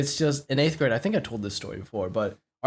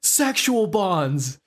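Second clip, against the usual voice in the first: drawn-out, high-pitched heckle; retold yell; 7 semitones higher, much slower than usual. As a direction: call it slowly from the back of the class. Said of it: "someone in the back just yells out".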